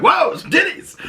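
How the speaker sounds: high, exclaimed playful surprise; mock amazement. Viewer glimpsing the flashing scene, high mock amazement. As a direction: blurt it out in delighted shock. Acting a viewer's startled reaction.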